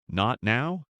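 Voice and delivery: plain reading voice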